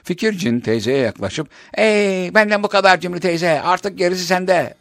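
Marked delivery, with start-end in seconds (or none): 1.7-4.7 genie voice. Acted. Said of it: "demiş".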